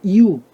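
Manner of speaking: 이유 said high and clear as two sounds, i then you; teacher modelling 이유; pronunciation demo. Shown as a demonstration. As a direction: model the syllables 이유 slowly and distinctly. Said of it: "어떤 발음입니까?".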